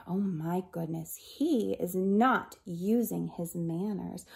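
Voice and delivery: usual speaking voice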